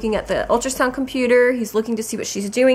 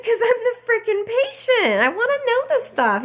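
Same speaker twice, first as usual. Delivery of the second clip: much higher, exasperated snap; indignant retort; 13 semitones higher than usual. Retold or imitated. Patient snapping at the ultrasound tech, high and indignant. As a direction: hit back at a dismissive tech.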